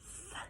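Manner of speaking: th breathed out unvoiced, tongue between teeth; just air on th, no voice; soft th demo. Shown as a demonstration. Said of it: "in between your teeth".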